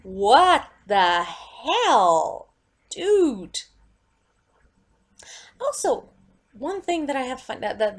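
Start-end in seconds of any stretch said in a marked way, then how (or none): 0.0-3.6 dragged out, very slow what the hell; slow disbelief. Retold or imitated. Fan reacting to the repeating actor, slow and disbelieving. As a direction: stretch it out in stunned disgust. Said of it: "and I'm like".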